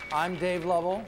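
ordinary delivery

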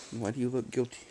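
normal talking voice

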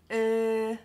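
drawn-out "uh" far forward in the mouth; French person thinking. Shown as a demonstration. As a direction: hold "uh" with lips pushed out, like a pondering French speaker. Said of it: "Imagine you're a French person thinking".

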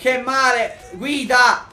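high, loud cries mid-fight; urgent shout. Real, not acted. Player getting hit orders partner to drive, urgent shout.

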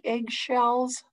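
plain reading voice

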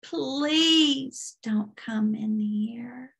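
very slow, creaking drawl; old squeaky-door demon. Retold or imitated. Demon telling her how long it has been there, slow creaky plea. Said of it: "an old squeaky door".

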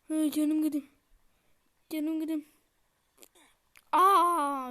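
loud wailing cry, pitch pushed up; dying wail. Real, not acted. Player wailing as his character loses health, loud and high. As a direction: cry out as your life drains away.

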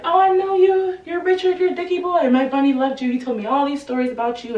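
a grieving father gushing over his son's friend; gushing dad. Retold or imitated. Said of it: "Immediately, Mister Corcoran's like".

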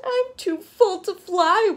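high, slow mock wail; self-pitying bee, acted; storybook wail. A narrator's reading. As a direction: wail it out, drawing the words long. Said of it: "wailed the greedy bee".

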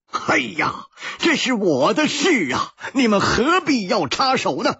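fretful, pushing help away; stamping frustration. A narrator's reading. Yidianhong protesting to his friends, high and exasperated. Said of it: "他跺着脚说".

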